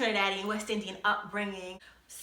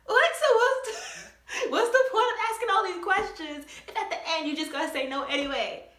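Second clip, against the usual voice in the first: high, laughing disbelief; outraged laugh; 10 semitones higher, 3 dB louder than usual. Retold or imitated. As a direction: laugh it out high in indignant protest. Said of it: "And I'm like".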